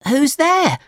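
high-pitched startled puppet cry; high little puppet calling out. A narrator's reading. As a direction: call out in a very high voice, without shouting. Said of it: "cried Pinocchio".

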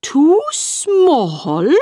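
high surprised cry on "Too small". Acted. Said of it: "cried the caterpillar".

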